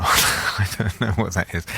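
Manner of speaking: said through a laugh; laughing aside. Real, not acted. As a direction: chuckle while admitting it. Laughs at his own example.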